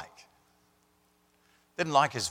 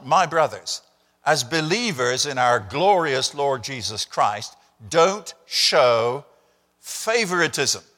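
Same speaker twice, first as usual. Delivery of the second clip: louder, measured reading of the verse; solemn scripture reading; 4 dB louder, slower than usual. Acted. Preacher reading verse one to the church, measured and louder. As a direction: read it out with weight, slowing down.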